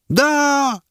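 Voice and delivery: the women shrilly yell back; women's shout. A narrator's reading. Women in the crowd disagreeing, high cry. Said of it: "не согласилась с ними женская".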